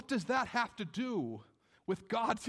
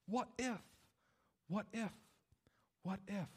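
anxious question, softer on each repeat; fading worry; 7 dB quieter than usual. Acted. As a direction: let the doubt die away in echoes. Abraham's doubts echo and fade.

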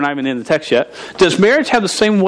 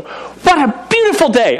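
high-pitched mock morning-person gush; sing-song high imitation of a cheery wife; chirpy morning wife; 11 semitones higher than usual. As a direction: imitate an early riser greeting the day, way up high. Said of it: "wake up at, like, five A M and just go".

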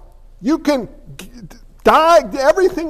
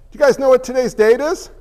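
ordinary talk, nothing marked

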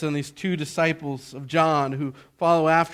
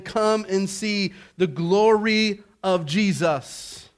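inviting plea; 4 semitones higher, 5 dB louder, slower than usual. Acted. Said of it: "going to them and saying".

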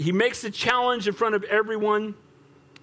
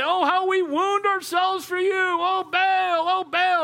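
sustained high wailing chant; hammed-up frantic pleading; crying out; 11 semitones higher, slower than usual. Dancing prophets beg their god, high wailing cry. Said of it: "they're just crying".

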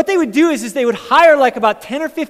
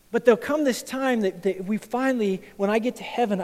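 ordinary delivery

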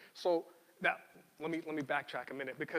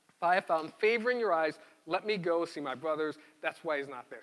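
louder, measured Bible reading voice; scripture reading; 5 dB louder than usual. Acted. Pastor reading Samuel aloud to the congregation, louder read-aloud. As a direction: read the passage out to the church.

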